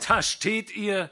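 loud, high, slow and sincere invitation; a vagrant fiddler heartily luring the lovers; hearty fiddler. A narrator's reading. Vagrant fiddler urging the young couple to run away, loud and hearty. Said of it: "in einem aufrichtigen und gemütlichen Tone".

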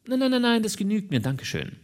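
high-pitched rapid protest, settling down; high, hurried no-thanks; hasty polite refusal. A narrator's reading. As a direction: wave the offer away quickly, then relax. Character hastily declines offered money.

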